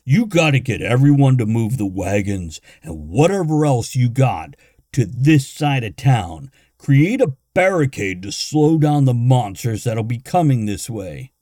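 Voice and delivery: loud, urgent orders; urgent barbarian. A narrator's reading. Barbarian urging the villagers, loud and urgent. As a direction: push hard, the monsters are coming. Gunther urges defenses.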